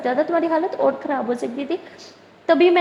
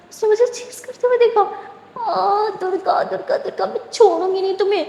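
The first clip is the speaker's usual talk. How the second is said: high, indignant cry of outrage; furious outburst; 7 semitones higher than usual. Acted. Simran realizing Durga watched her change, furious high outburst. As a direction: spit out her fury at being watched. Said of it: "سمرن کو بہت غصہ آتا ہے".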